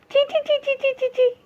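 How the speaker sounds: very high soft squeaks; mouse squeaking. Acted. Mouse squeaking in fear at the cat. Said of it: "The first picture says".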